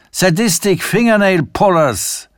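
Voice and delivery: high, braying laughing delivery of the punchline; neighing laugh. A narrator's reading. Sanford roaring with laughter at his own joke. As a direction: Sanford whinnies out his joke, hitting the steering wheel. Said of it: "Sanford wieherte".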